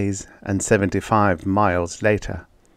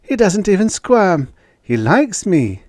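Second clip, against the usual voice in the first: delighted; 11 semitones higher, 5 dB louder than usual. Acted. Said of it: "he cried delightedly".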